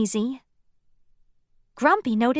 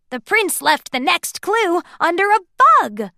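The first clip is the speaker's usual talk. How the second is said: excited; 7 semitones higher, 6 dB louder than usual. A narrator's reading. Sneezy eagerly explains the clue.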